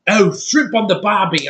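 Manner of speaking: mock Australian accent. Reviewer mocking foreign actors' speech, hammed-up Australian accent. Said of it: "They don't have shitty accents like".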